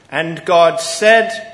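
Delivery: slow right down and lean on "said"; slow emphatic quote. Shown as a demonstration. Preacher making the church hear each word, slow and emphatic. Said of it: "we read over those words so quickly".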